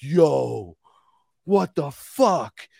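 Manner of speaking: drawn-out, slow "what the fuck"; stunned disbelief. Acted. Man finally hearing the spirits, stunned slow disbelief. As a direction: imitate a stunned reaction, stretching each word.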